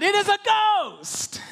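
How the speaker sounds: high, slow cry of "It is a ghost!"; mock frightened cry. Disciples on the boat cry out at Jesus, high and mock terrified. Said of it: "they cry out".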